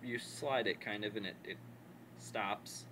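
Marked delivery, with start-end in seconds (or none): none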